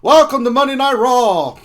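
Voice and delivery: hyped Monday Night Raw intro; WWE announcer. Pretend. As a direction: open the show like a wrestling broadcast. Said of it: "like the W W E announcer".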